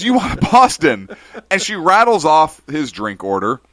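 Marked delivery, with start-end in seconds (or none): 0.0-1.1 high, bright surprised question; delighted question. Retold or imitated. Barista thrilled to see her regular, high and loud. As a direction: ask it thrilled to see him. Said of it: "And she goes".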